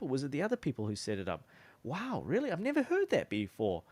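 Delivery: curious surprise. Shown as a demonstration. Salesman reacting to a failed campaign with curious surprise. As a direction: play it as wide-eyed curious surprise. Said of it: "with childlike curiosity".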